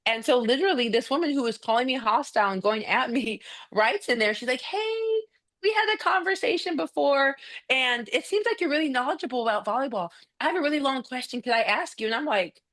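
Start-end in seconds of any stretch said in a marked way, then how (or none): 4.6-12.1 high, sugary imitation of a polite mom; mock sweet mom. Coach imitating the hostile mom now asking nicely, high sugary mock voice. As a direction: sweeten it up, playing the mom who was hostile.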